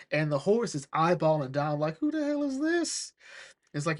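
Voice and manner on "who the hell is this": high-pitched mock bafflement; acted affronted who-is-this, pitched way up; indignant disbelief. Acts the horse eyeballing Donald.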